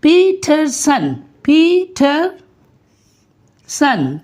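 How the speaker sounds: पीटरसन broken slowly into phee, thur, sun; slow three-beat breakdown of पीटरसन; syllable by syllable. Shown as a demonstration. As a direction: stretch पीटरसन into three separate sounds, pausing between them. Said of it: "मैं इसकी sounds बनाने लगा हूँ".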